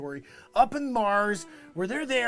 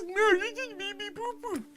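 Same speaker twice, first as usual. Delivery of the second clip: mocking Tim's high voice; 14 semitones higher, 3 dB louder than usual.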